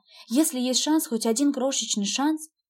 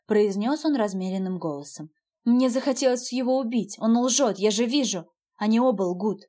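ordinary delivery, nothing marked

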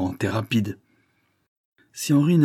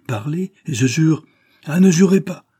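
higher, louder dramatic interjection; urgent plea; 7 semitones higher, 7 dB louder than usual. A narrator's reading. Lover stopping the other from swearing an oath, urgent. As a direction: cut in with a high, forceful plea.

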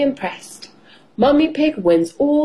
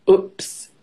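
ordinary delivery

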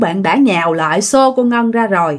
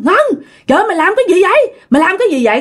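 high-pitched mock shout of alarm; crying out in alarm; 9 semitones higher, much faster than usual. Grandmother catches Ngân with pesticide, crying out in alarm. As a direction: act out a grandmother's horrified cry. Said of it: "mới la làng lên".

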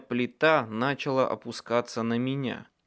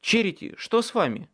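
встревоженно; 7 semitones higher, 3 dB louder, faster than usual. A narrator's reading. Hero alarmed at groaning woman.

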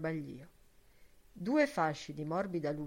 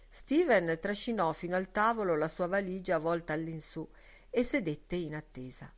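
ordinary delivery, nothing marked

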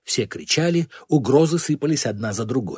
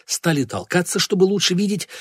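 ordinary delivery, nothing marked

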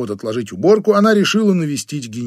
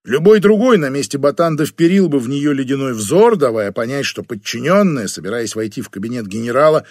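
ordinary delivery, nothing marked

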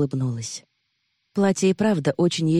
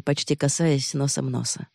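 plain reading voice

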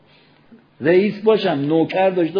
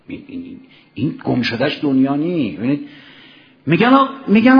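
ordinary delivery

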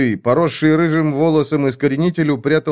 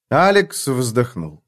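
usual speaking voice

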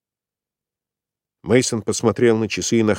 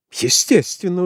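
smirking, amused and higher; 10 semitones higher than usual. A narrator's reading. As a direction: say it with a mocking grin. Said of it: "усмехнулась свидетельница". (с усмешкой)

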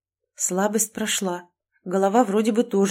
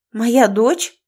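louder snapped question; a loud, furious question about her daughter; barked; 3 semitones higher, 7 dB louder than usual. A narrator's reading. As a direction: bark it out in sudden rage. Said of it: "рявкнула".

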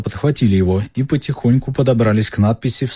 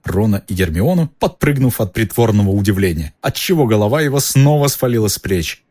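ordinary delivery

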